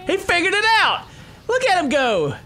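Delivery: high, thrilled exclamation; excited cheer. Real, not acted. Player watching the Titan come through, excited cheer. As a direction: cheer him on, pitch way up.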